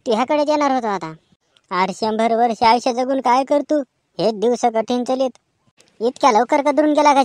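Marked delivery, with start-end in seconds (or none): none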